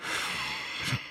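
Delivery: breathy hissed vampire laughter; hissing laugh. Acted. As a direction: wet hissing chuckle. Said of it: "He hissed out a dripping runnel of laughter".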